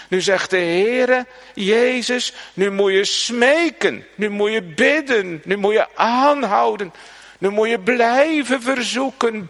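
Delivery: loud, high, impassioned exhortation; fervent preaching. Acted. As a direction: drive the command home to the congregation. Preacher urging prayer with force. (predikant die de gemeente aanspoort: vurig en luid)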